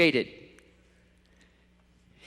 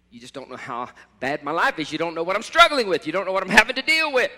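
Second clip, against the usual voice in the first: building, louder and higher, fast protest; aggrieved complaint; 3 semitones higher, 6 dB louder than usual. Retold or imitated. Preacher voicing a struggling believer complaining, rising protest. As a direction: let the grievance spill out faster.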